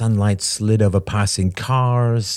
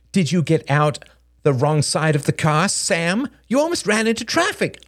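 high pitch, climbing as it goes; mounting, high-pitched reprimand; scolding mother; 9 semitones higher than usual. Acted. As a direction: tell off a careless child. Mother scolds her small son.

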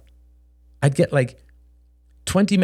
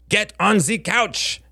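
high, slow, barking fake German accent; 9 semitones higher, slower than usual. A narrator's reading. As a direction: bark it in a put-on German accent. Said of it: "barked Helen in a faux German accent".

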